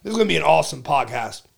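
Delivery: big show-opener energy, punching the names; hype podcast host intro. Acted. Host welcoming listeners to the podcast, hyped announcer.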